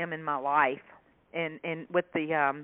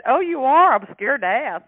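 mock worry; 7 semitones higher, 10 dB louder than usual. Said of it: "I said".

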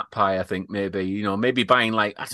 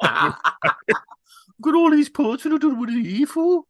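high-pitched, garbled Brummie caricature; Brummie accent impression; 11 semitones higher, much slower than usual. Brummie local in talk with Mahomes, mock Brummie accent. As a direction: put on a silly high Brummie voice. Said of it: "someone with a Brummie accent".

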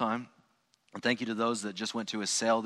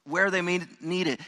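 preaching; 7 semitones higher, 5 dB louder than usual. Acted. Preaching prayer build-up.

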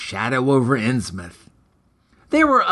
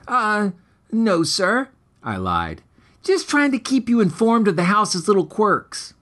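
put-on higher, innocent boy fibbing; boy covering a lie to his stepfather, higher; lightly higher kid voice; 6 semitones higher than usual. A narrator's reading.

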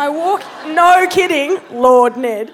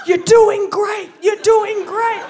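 higher, louder mock encouragement; cheering shout; 10 semitones higher, 5 dB louder than usual. Trainer shouting encouragement at the struggling newcomer, loud. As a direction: yell it like a coach from the side. Ned cheering her from the side.

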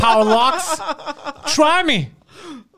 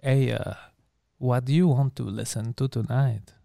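low, softer smooth-talker voice; mock seducer; 8 semitones lower, 8 dB quieter than usual. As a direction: lay on a mock late-night come-on.

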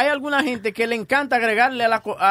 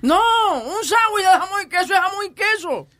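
exasperated shout; 7 semitones higher than usual. Real, not acted. Exasperated rant about overloaded sandwich.

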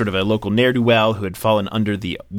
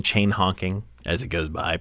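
ordinary talk

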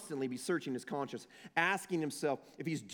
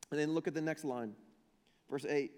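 usual speaking voice

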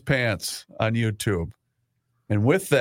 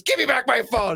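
mom voice; 14 semitones higher than usual. Retold or imitated. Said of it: "And then she goes".

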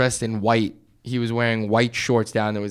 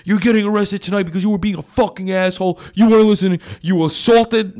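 higher, louder, fast cop tirade; yelling cop; 6 semitones higher, 3 dB louder than usual. Retold or imitated. Cop screaming at the arrested people, loud and fast. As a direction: rattle it off like a furious cop.

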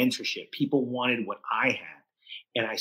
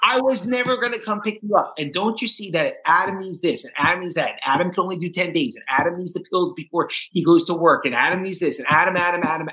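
wife's mocking rant, higher and a bit louder; 6 semitones higher, 3 dB louder than usual. Wife unloading on her addict husband, scornful higher mimicry. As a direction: mimic her listing his needs, scornful. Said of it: "And then I heard".

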